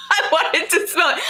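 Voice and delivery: high, laughing confession. Real, not acted. To her viewers, laughing at her own weakness. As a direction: giggle it out, caught being silly.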